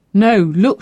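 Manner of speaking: usual speaking voice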